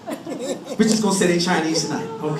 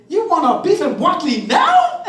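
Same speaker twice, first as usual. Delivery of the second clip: high-pitched comic indignation; incredulous outburst; 7 semitones higher, 4 dB louder than usual. Acted. Husband to bride on their honeymoon, incredulous. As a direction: burst out in mock disbelief. Husband's outraged reply to wife.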